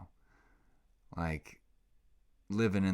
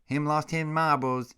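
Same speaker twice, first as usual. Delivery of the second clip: put-on higher and louder silly baby talk; goofy baby talk for the dog; doggy baby talk; 9 semitones higher, 6 dB louder than usual. Owner showing his new puppy line, in louder high baby talk. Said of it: "I came up with a new one".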